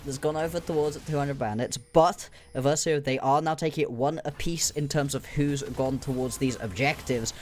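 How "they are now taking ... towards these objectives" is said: ordinary talk, nothing marked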